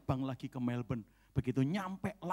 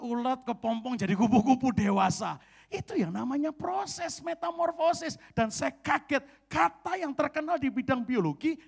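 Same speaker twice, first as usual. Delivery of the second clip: high, loud, driving sermon; full-force preaching; 11 semitones higher, 8 dB louder than usual. Acted. Pastor stirring the congregation about metamorphosis, fiery preaching.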